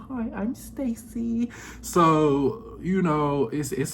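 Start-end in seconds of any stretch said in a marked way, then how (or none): 0.1-1.5 high, slow, prim prissy voice; proper bougie Stacey. Retold or imitated. Vlogger mimicking Stacey's proper, bougie way of talking. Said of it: "real proper, real bougie".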